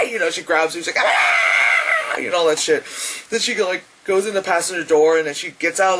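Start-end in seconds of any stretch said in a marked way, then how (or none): 1.0-2.2 long, very high loud shriek; acted scream of being grabbed; mock shriek.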